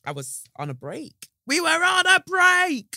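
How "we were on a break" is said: high, loud and slow, hammed-up; mock sitcom line. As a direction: fling it like a sitcom catchphrase. Friends quote after "on a break.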